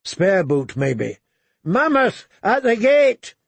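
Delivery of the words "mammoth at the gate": high alarm cry; raised, urgent lookout call; shouting. A narrator's reading. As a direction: shout the warning down the line. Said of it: "a shout came".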